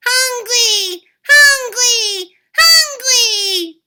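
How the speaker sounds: put-on loud, high wailing baby cries; baby Finn hollering for food. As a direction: bawl like a giant infant. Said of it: "The baby began to holler too".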